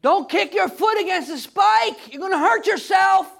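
loud, high warning shout; urgent shouted plea from God; screaming out. Acted. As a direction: yell a warning to stop him. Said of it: "calling out and screaming out".

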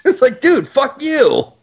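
mock cartoon voice. Quoting South Park, high.

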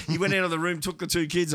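normal talking voice

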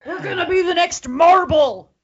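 high, booming mock proclamation; loud boss announcement. Woken-up wrestling boss declares his new plan, loud and high. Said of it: "and for him to go".

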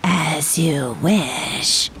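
low, very slow ominous reply; obedient villain. Acted. Servant granting a wish, low slow ominous voice. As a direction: draw it out low and menacing. Henchman obeys an order.

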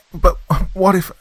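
high, loud pleading, cut off. Acted. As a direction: plead before he interrupts.

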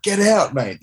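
high-pitched mock yell; indignant acted-out protest; retold arguing. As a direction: order an intruder out, pitch jumping up. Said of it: "we're arguing".

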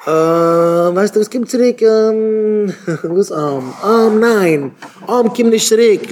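high, drawn-out stalling with long "uh"s; hemming and hawing. Acted. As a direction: struggle to get the no off your tongue. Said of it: "ליגט אים אויף דעם צונג".

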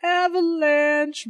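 high, slow, stretched warning cry; drawn-out alarm call. Acted. Fake alarm called out to town.

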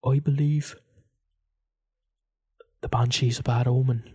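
halting, portentous Irish accent; put-on Irish accent. Shown as a demonstration. Host giving a banshee theory, Irish accent. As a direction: pause between phrases, in Irish accent. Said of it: "give you my theory in an accent".

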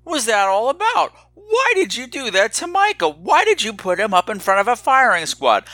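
high-pitched mock indignation; baffled new listeners. Podcaster voicing new listeners' questions, mock baffled. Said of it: "you're probably wondering".

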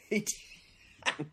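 breathless laugh through words. Real, not acted. Laughing at the joke.

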